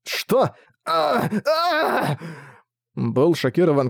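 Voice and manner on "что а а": startled high yelps; shocked cry. Acted. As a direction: gasp out in shock. Shocked king cries out.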